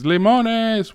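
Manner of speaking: high, sing-out street seller's call; imitated vendor's yell. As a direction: call it out high like a fruit hawker. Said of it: "he would yell other things".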